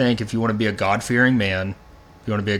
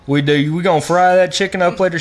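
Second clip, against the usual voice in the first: a hammed-up, higher, louder imitation of his partner; exaggerated mock voice; 8 semitones higher, 5 dB louder than usual. Cohost mocks his partner's voice at the coffee shop, hammed-up and loud. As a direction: ham up her coffee-shop question, pitched up and loud. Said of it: "She doesn't sound like that".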